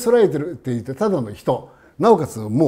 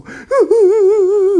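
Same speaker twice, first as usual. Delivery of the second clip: high, loud drawn-out sob, imitating a grieving wife; mock wailing; 8 dB louder, much slower than usual. The old wife weeping for her daughter, hammed-up high loud wailing. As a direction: wail like a mother losing her daughter. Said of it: "こう泣いてるわけですよ".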